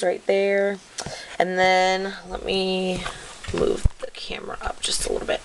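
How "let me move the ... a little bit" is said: fast whispered aside to herself. Real, not acted. Vlogger whispering to herself while moving her camera up. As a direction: breathe it out quickly, no voice.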